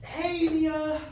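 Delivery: answering a forgotten friend's call; bright greeting. Acted. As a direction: cover guilt with enthusiasm. Answering phone call from Mia.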